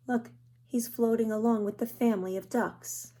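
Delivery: plain reading voice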